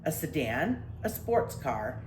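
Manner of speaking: ordinary delivery, nothing marked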